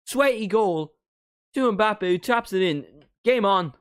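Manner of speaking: building to a high, loud commentator shout; excited goal call. Real, not acted. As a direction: call the goal at full volume, pitch soaring. Live reaction to a goal.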